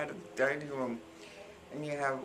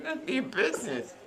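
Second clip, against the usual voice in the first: snappy retort; 3 semitones higher, 4 dB louder than usual. Retold or imitated. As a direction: snap back at a nosy neighbour. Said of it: "I said".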